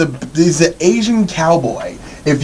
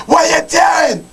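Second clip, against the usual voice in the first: mock Ramsay yell; 11 semitones higher, much faster than usual. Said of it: "the show where I yell at people".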